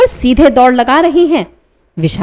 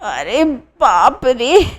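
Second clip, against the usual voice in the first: slow, softer pained groan; 4 dB quieter, much slower than usual. A narrator's reading. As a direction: let it drag out with pain. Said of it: "कराहते हुए".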